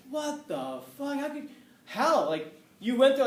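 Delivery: high, slow, loud disbelieving cry; shocked disbelief. Retold or imitated. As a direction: act floored, as if it can't be true. Classmates shocked he went to college.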